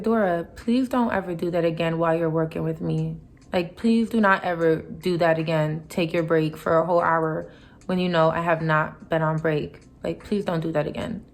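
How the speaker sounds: low, controlled, polite request; firm but courteous, voice kept down; respectful, professional. Retold or imitated. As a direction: calmly lay down a boundary without raising your voice. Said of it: "in a very respectful, professional way".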